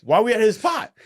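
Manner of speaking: very high, loud disbelief; retold alarmed protest. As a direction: protest in near-yelled alarm. Alarmed at being at suspect's spot.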